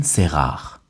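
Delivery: ordinary talk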